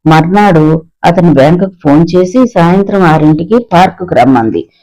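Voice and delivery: plain reading voice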